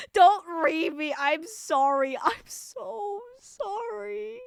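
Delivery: drawn-out apology rising high at the end; mock-pitiful apology. Streamer begging chat not to judge, mock-pitiful.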